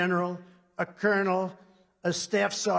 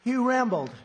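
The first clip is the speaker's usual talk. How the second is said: mock critic; 6 semitones higher, 6 dB louder than usual. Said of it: "They'll say".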